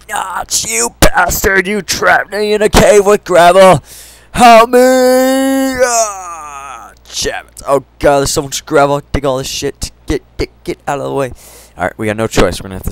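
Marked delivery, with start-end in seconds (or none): 0.1-6.9 mock outrage. Mock rage at the game creator.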